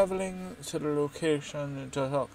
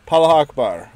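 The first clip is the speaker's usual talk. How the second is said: joking shout; 4 semitones higher, 14 dB louder than usual. Pretend. Joke name shouted.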